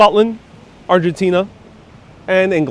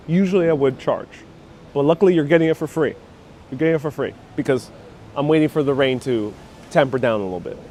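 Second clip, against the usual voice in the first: ordinary delivery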